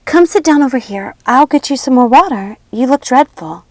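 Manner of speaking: put-on gentle girl tending her friend. A narrator's reading. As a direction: fuss over a sick friend. Snow comforts Circe.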